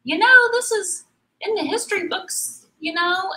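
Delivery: high-pitched imitation of a chiding daughter; mock scolding daughter. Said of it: "my daughter was kind of scolding me".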